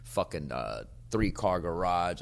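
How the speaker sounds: ordinary talk, nothing marked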